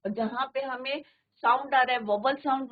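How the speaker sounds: usual speaking voice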